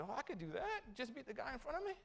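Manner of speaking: put-on young runner thinking it's easy; eager realization. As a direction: discover it's easy, excited. Young self quoted, eager.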